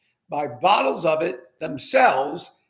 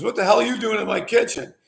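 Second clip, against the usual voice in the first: higher-pitched mock yell; half-hearted yell, pitched up; retold yell; 6 semitones higher than usual. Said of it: "yelled at me".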